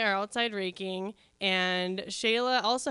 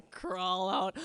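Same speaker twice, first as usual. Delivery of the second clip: usual speaking voice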